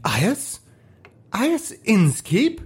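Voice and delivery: high, slow, wondering call of a name; a stranger stunned to finally find him; astonished exclamation. Acted. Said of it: "fixed his eyes upon our tiefling friend and exclaimed".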